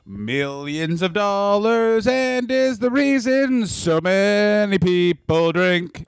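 slow, grand trailer narrator; dramatic announcer. Acted. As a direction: build it up like a movie trailer. Mock show intro continued.

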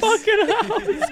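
slow high laughing echo. Real, not acted. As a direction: repeat it while cracking up. Echoing joke, laughing.

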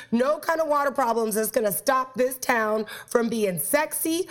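usual speaking voice

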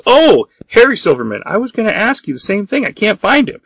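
high, fretful little voice; fussy assistant voice. Retold or imitated. Assistant answering Clay about the missing executive, high and fretful. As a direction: play it high and flustered. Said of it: "he goes".

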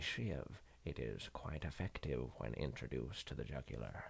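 politely menacing Morgan Freeman; Morgan Freeman impression. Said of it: "pretend to be Morgan Freeman".

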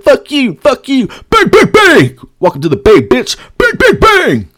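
high-pitched, jeering trash talk; taunting the boos. Retold or imitated. Kicked-out fan to booing crowd, high taunting voice. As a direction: yelp taunts and bangs at the crowd.

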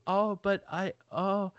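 impression of friend. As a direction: play the meek friend stammering, lightly. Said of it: "that's a great impression of you".

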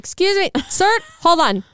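high, prim excuse-me voice; lightly hammed polite interruption; mock polite. Interrupting someone in church for a call, mock prim. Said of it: "and I'm like".

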